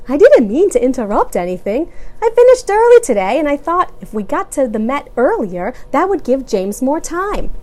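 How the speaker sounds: casual dad; man voice. A narrator's reading. Carl voiced in story.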